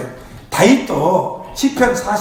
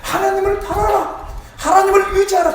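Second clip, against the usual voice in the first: rising higher, urging his own soul; speaking to his soul; 6 semitones higher than usual. Retold or imitated. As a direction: lift the voice as you exhort yourself. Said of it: "자기한테 얘기하는".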